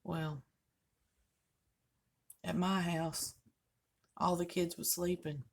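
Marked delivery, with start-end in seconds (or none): none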